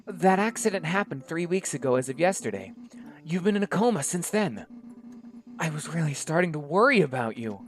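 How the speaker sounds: a doctor fussing over her waking friend; eager young doctor. Acted. Izzy voice continues.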